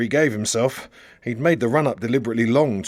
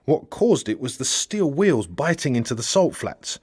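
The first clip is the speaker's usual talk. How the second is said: usual speaking voice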